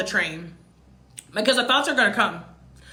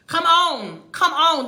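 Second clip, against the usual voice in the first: exasperated come on; 5 semitones higher, 4 dB louder, slower than usual. Retold or imitated. Exasperated retold self-talk.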